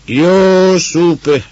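loud, high, drawn-out call; long, ringing high cry; full shout. A narrator's reading. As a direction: shout the name far into the empty darkness. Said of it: "A kiáltás elveszett".

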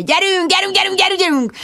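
high, rapid-fire urging; frantic yelling. A narrator's reading. Richard yells at Bridget to get the horse moving. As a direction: hammer the repeats in a high shout. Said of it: "ordította Richard".

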